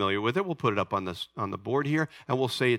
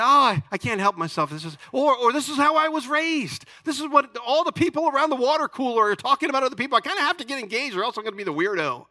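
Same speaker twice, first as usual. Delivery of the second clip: higher-pitched self-excusing voice; mock excuses, pitched up and rattled off; excuse-maker; 7 semitones higher than usual. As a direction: imitate someone protesting they can't help it. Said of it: "If somebody say".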